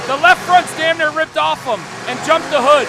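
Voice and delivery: loud, high astonished call; excited shout. Real, not acted. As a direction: shout it as the car jumps. Crash in the arena.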